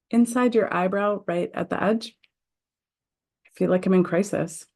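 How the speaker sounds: measured, deliberate affirmation read with pauses; guided tapping script. Acted. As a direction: lead the group through each setup phrase. Guides tapping round phrases.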